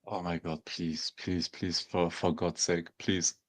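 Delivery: low, soft, repeated begging; hushed, halting plea; quiet pleading. Real, not acted. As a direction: plead under your voice, almost praying. Begging for the deal.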